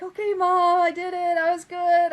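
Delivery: put-on high, bright little-kid report to mom; proud kid. Calling her mom on the way home, a proud childlike report. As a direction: boast like a child wanting praise.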